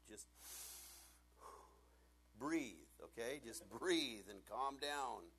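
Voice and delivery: slow calming. Shown as a demonstration. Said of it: "I'm speaking from experience".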